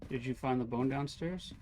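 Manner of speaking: ordinary talk, nothing marked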